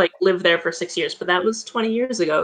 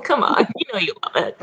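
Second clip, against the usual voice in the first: teasing laugh; 8 semitones higher, faster than usual. Real, not acted. Teasing her friend about her name, breathy laughing whisper. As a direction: giggle the tease out.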